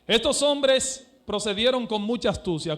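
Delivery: ordinary talk, nothing marked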